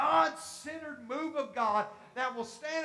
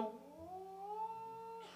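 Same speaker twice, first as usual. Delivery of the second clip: high, soft, long-drawn sound of wonder; astonished onlooker; 9 semitones higher, 14 dB quieter than usual. Acted. Preacher mimicking people puzzled by worship, amazed. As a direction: imitate amazement with a rising coo.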